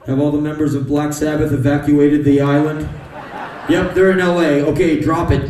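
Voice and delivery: very loud barked radio orders; mock command. Giant comic ordering the drop, loud. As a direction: bark it like a command center.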